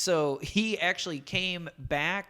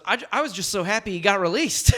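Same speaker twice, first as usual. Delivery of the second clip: raised, louder imitation of Rodman; mock Dennis Rodman; 5 semitones higher, 7 dB louder, faster than usual. S0 imitating Dennis Rodman's response, raised and gushing. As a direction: gush like an earnest Rodman. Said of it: "Dennis Rodman goes".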